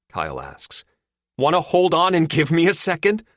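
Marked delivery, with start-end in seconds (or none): 1.4-3.3 much higher, louder irritated retort; snapping. A narrator's reading. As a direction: snap back at Kyle. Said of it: "Lou snaps".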